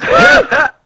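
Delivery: high, loud shocked What?; shocked shriek. Acted. Girl shrieks at being told to be silent, high loud shocked. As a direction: shriek it in disbelief. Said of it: "And she goes".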